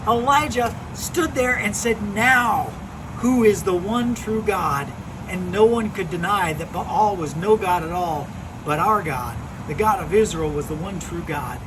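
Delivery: put-on animated storyteller voice for kids; lively Bible story narration. Youth pastor telling kids a Bible story, animated narration. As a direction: tell it like a children's pastor spinning a tale.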